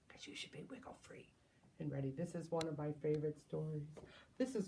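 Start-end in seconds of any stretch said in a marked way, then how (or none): 0.1-1.3 quick playful whisper; quick whispered joke. Pretend. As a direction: hush it fast, a secret for the kids. Whispered aside to kids.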